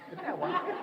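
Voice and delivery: very high, delighted coo; high parent cooing with delight; sing-songy parentese. Retold or imitated. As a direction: squeal it to a baby. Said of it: "that sing-songy thing".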